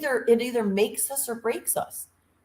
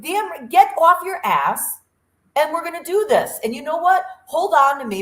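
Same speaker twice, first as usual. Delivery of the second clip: higher, forceful acted pep talk; rally a flagging friend, pitched up; tough-love urging; 6 semitones higher, 5 dB louder than usual. Speaker imitates friend refusing to let them quit, tough love.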